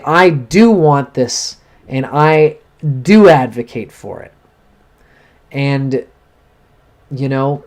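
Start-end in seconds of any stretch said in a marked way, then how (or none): 0.0-4.3 slow, firm and a bit louder; firm declaration. Shown as a demonstration. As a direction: say it like you mean every word. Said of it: "and you say".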